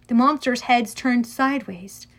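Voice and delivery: ordinary delivery, nothing marked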